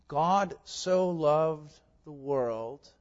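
ordinary delivery

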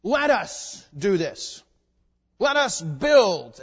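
slow, loud, grand proclamation; proud builders' call. Retold or imitated. Preacher voices man parroting God, slow and grand. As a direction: declare it slowly like a builder king. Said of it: "He says".

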